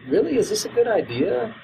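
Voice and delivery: high, hesitant worried questioning; nervous doubt. Retold or imitated. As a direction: voice Riley's sudden cold feet. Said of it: "suddenly sounds kind of like".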